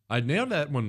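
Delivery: ordinary talk, nothing marked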